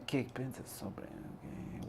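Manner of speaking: whispered under the breath, voiceless. Shown as a demonstration. Reading a chat question aloud to himself, whispered. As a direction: softly whisper the Spanish back to yourself.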